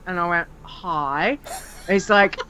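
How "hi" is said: low, soft, unenthusiastic hello; reluctant greeting. Retold or imitated. Irked gamer answering an eager stranger, reluctant.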